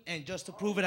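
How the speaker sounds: ordinary talk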